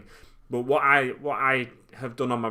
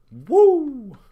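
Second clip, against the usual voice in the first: high, loud whooping cheer; excited whoop; 13 dB louder than usual. Real, not acted. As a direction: let out a big hype whoop. Hype cheer on stream.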